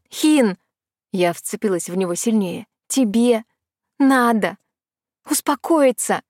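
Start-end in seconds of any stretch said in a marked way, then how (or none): 0.1-0.6 higher, raised-voice call; urgent call. A narrator's reading. Sister grips Hin as the ground shakes, calling urgently. Sister calls raging brother. 2.9-6.2 word by word, with firm pauses; emphatic urging. A narrator's reading. Sister clinging to raging Hin, urging emphatically.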